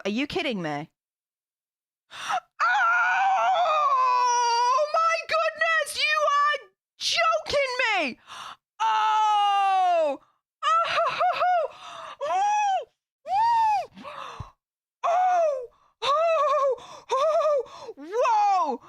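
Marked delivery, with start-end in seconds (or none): 2.1-4.6 sharp, high shocked gasp; a shrieking gasp of shock; huge gasp. Real, not acted. Reacting to hitting subscriber milestone. 4.6-8.2 much higher, crying shout of joy; overwhelmed happy shriek; tearful disbelief. Real, not acted. Streamer stunned by milestone. 8.8-18.8 overwhelmed happy screams; joyful shrieks. Real, not acted. Shrieking at milestone.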